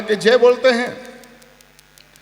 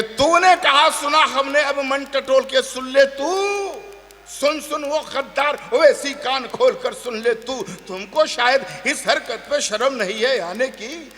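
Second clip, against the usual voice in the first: slow, higher declamation; poem recitation; 5 semitones higher than usual. Acted. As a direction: recite with rising challenge.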